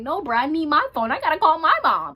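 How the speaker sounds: loud, high-pitched frantic objection; inner protest. Retold or imitated. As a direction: protest urgently, as an unfiltered inner thought. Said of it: "in my head. I'm like".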